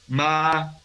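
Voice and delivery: high, level, held ma; first tone. Shown as a demonstration. Teacher showing Spanish speakers Chinese tones, high sustained first tone. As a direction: hold MA long on a high even pitch. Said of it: "el primer tono es alto".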